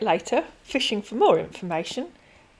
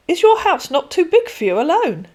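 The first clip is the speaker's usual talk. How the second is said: higher, louder insistent repeat of Is your house not too big for you alone; pushy demand; 9 semitones higher, 8 dB louder than usual. Acted. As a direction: press her like a bully. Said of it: "turning into a bully".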